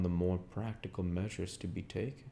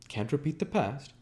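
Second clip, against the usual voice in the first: incredulous; 8 semitones higher than usual. A narrator's reading. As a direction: throw the words back in disbelief. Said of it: "he cried incredulously".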